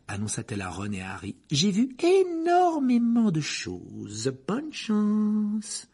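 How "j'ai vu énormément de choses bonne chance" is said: put-on slow, self-satisfied girl's voice; girl boasting to her friends, slow and pleased; smug Parvati. A narrator's reading. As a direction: preen over her exam result.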